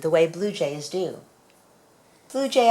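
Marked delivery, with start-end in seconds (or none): 2.3-2.7 calling out. Acted. Child calls to blue jay.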